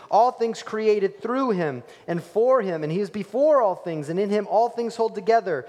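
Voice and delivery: preached scripture, building; proclaimed scripture. Real, not acted. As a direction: read it like a herald. Scripture read with force.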